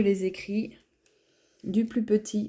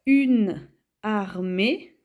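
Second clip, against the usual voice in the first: very slow, louder dictation; dictation pace; 4 dB louder, much slower than usual. Shown as a demonstration. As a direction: draw out each syllable for writing it down. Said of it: "dictating words to the class".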